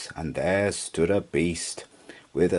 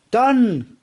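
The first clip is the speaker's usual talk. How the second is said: high ringing cry; unicorn's bright call; 5 dB louder than usual. Acted. Unicorn granting the horse's wish, a high bright cry.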